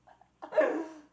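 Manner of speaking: loud, high burst of laughter; sudden real laugh. Real, not acted. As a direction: crack up mid-count. Cracks up while counting aloud.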